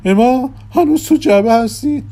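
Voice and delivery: very high, loud put-on voice; high-voiced magician urging calm; magician character voice. A narrator's reading. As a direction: pitch it way up and call out. Magician's acted voice, calming and calling out.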